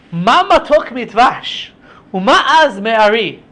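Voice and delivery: drawn-out answer to a riddle; triumphant answer recital. Acted. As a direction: recite it slowly and emphatically.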